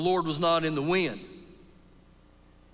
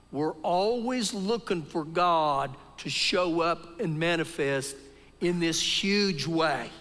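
ordinary talk

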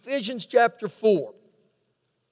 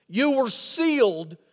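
slow emphatic. Real, not acted. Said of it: "preacher drives home verse".

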